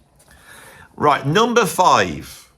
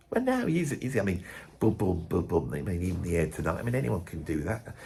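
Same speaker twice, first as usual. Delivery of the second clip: quieter, offhand know-it-all voice; mock dismissive colleague; 7 semitones lower, 12 dB quieter than usual. Colleague dismissing [name] to musicians, quiet and sneering. Acting the crap musician's reply.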